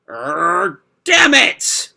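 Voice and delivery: frustrated shout. Acted. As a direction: blurt it out through frustration. Natsu frustrated, forced choice.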